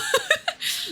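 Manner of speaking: soft, high giggle; short laugh. Real, not acted. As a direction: laugh it off briefly. Laughs off short-lived headcanon.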